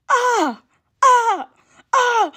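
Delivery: very high, repeated mock yelps of a boy being beaten; imitated cries of pain. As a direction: yelp each "ah" like the boy getting whooped. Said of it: "All I heard was".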